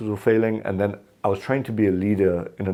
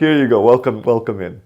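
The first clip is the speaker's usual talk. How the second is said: louder and higher, laughing through it; laughing mock welcome; 3 semitones higher, 7 dB louder than usual. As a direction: gleefully play the eager recruiters. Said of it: "so they're like".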